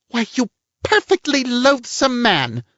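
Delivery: very high, slow, outraged squawk. A narrator's reading. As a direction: screech it like an offended hen. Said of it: "she squawked".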